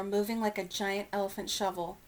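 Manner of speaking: usual speaking voice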